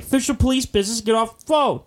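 high, pushed "official police business" shout; mock cop shout. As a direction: bark it like a fed-up cop clearing the line. Samuel Jackson roept naar vrouw.

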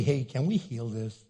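reach out gently to heal; softly sincere plea; tender plea. Shown as a demonstration. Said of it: "go and say".

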